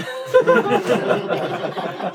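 high breathless wheeze of laughter; silent, wheezing giggle. Real, not acted. Reader laughing at the living butt, a breathless wheeze. Reader cracks up at own story.

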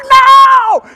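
long, high, full-volume "no" of horror; screaming at the screen. Retold or imitated. Moviegoer sucked into the movie, screaming at the screen. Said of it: "I literally screamed at the screen".

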